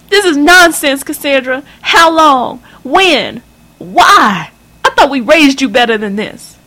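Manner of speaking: high, loud enraged mother's voice; a scandalised mother tearing into her daughter; furious mother. Acted. Mother keeps berating.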